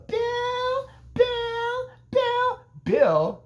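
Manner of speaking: very high falsetto calls; mock calling out. Alice searching for Bill, high mock calling. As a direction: imitate a woman calling out in falsetto.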